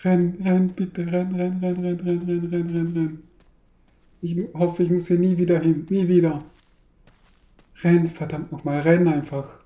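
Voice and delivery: breathless frantic chanting; being chased. Real, not acted. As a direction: repeat run, run, urgently. Frantic chant while fleeing.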